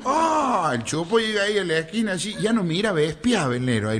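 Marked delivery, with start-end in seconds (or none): none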